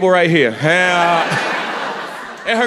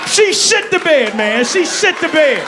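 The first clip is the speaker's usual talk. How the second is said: outraged shout; 8 semitones higher than usual. Real, not acted. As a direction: shout it in disbelief. Outraged punchline about the bed.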